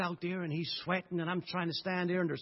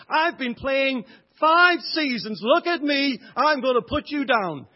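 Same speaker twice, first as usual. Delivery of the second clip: loud, higher bragging taunt, slow at first; boastful boy; 6 semitones higher, 9 dB louder, slower than usual. Retold or imitated. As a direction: puff up and sneer at the newcomer. Preacher voices the bragging boy.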